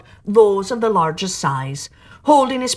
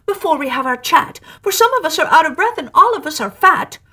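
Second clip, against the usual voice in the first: high, loud, breathless little voices; anxious oysters; 8 semitones higher, 6 dB louder than usual. Acted. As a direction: pile on the excuses in a squeaky panic. Oysters protest to the walrus.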